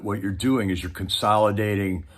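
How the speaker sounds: ordinary talk, nothing marked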